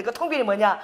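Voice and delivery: ordinary talk, nothing marked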